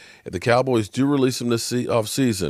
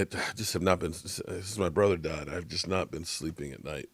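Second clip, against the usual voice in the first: usual speaking voice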